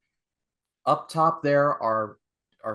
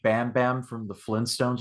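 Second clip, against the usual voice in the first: usual speaking voice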